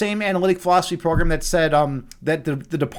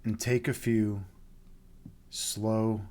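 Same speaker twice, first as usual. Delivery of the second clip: much lower, much softer, slow meditation voice; guided meditation; 9 semitones lower, 14 dB quieter, slower than usual. Acted. Host guiding viewers into meditation, soft and slow. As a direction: ease them down into stillness with long pauses.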